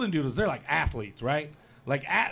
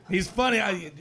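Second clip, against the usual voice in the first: normal talking voice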